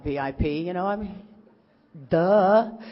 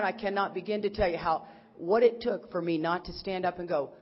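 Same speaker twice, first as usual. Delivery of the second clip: usual speaking voice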